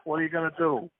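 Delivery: hammed-up New Yorker, stretching the last words; New York accent. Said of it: "New York, it's like".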